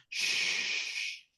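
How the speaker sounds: long hushing "Shh"; steady stream of air, "Shh", no vibration; unvoiced shh for comparison. Shown as a demonstration. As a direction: demonstrate a voiceless shh. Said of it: "agarra tu mano y compáralo".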